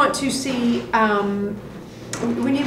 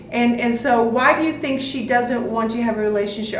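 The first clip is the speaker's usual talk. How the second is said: ordinary talk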